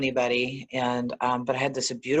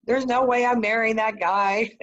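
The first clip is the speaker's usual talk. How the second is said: much higher, louder yelling at God; retold scream; 11 semitones higher, 4 dB louder than usual. Retelling her yelling at God in the park, high and loud. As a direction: cry out in a high, indignant protest. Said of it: "I was screaming at God".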